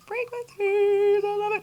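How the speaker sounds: high, slow, drawn-out frequencies; rapturous drawl. Real, not acted. Telling viewers about frequencies, high slow drawn delight.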